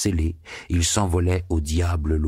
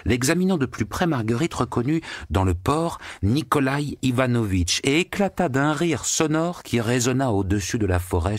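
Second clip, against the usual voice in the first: usual speaking voice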